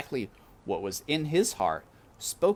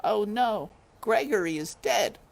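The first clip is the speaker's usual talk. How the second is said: lightly voiced dismayed mouse; 13 semitones higher, 4 dB louder than usual. A narrator's reading.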